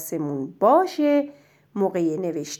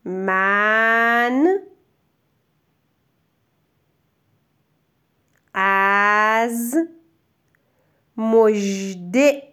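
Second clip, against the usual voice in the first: very slow, each word separated by long pauses; word-by-word dictation; much slower than usual. Shown as a demonstration. Teacher dictating a sentence slowly for writing. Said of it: "پس جملمون اینجوری شد".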